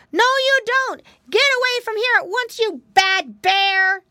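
loud, very high piggy shout; crying out. A narrator's reading. Piggy boys ordering the bear away, crying out. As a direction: cry out high and loud, drawing out the end. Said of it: "Curly and Flop cried out".

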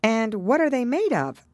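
high-pitched, keenly curious question; great curiosity. A narrator's reading. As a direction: ask as if dying to know, pitched high. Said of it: "in a tone of great curiosity".

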